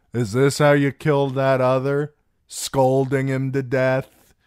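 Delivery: loud, high, tipsy jeer; jovial, jeering swagger; mocking drunken singer. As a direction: taunt the scold with boozy scorn. Darion mocks Sam's scolding.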